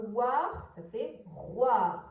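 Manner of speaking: "roi" drawn out slowly with its closing R; syllable demonstration. Shown as a demonstration. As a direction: stretch "roi" as the syllable roir. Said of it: "Donc, nous avons".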